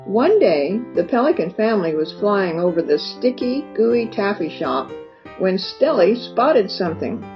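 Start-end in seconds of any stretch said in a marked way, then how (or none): none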